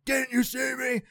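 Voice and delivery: high-pitched yelled accusation; imitating an angry old man shouting. Old driver yelling at him in the lot, mock shout. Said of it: "he was like".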